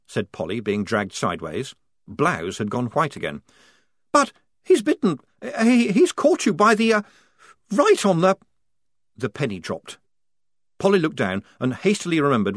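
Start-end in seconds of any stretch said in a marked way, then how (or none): 4.1-8.4 high, stammering flustered officer; stumbling over the embarrassing word; flustered panic. Acted. Lieutenant stammers in horror at the bite, high.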